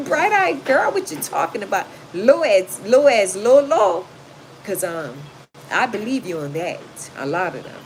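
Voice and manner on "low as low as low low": emphatic. Real, not acted. Emphatic repeat of chat comment.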